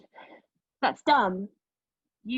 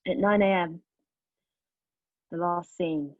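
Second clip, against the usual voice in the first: usual speaking voice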